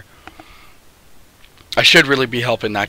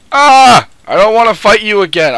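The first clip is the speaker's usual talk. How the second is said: annoyed outburst; 8 semitones higher, 8 dB louder than usual. Real, not acted.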